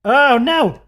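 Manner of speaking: sudden high alarmed oh no; alarmed cry. Real, not acted. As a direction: yelp of dismay. Editing goes wrong on screen.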